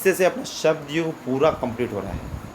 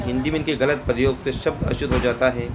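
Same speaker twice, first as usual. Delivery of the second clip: normal talking voice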